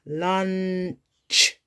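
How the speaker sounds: LUNCH stretched out, sounds run into one with a long final CH; teacherly, drawn-out LUNCH, each sound held and joined; blending sounds together. Shown as a demonstration. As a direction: slowly blend LUNCH for young readers. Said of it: "blending it together".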